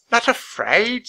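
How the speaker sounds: put-on high, querulous old woman's question; lightly voiced frail old woman; feeble voice. A narrator's reading. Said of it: "said a feeble voice".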